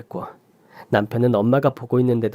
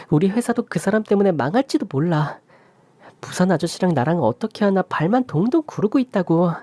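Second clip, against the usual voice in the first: higher, pleading daughter voice; urgent daughter; 7 semitones higher than usual. Acted. Daughter urging her father to call the police, high and anxious. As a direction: press him anxiously.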